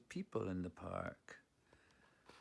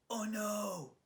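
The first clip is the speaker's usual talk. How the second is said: much higher, storybook gasp of alarm; 10 semitones higher than usual. Acted. Narrator shows the children the dads packing with a high gasp. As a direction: gasp at the picture for the kids.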